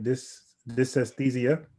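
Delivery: Dys-, dysesthesia broken into slow separate syllables; staccato. Shown as a demonstration. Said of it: "I'm gonna break this word up".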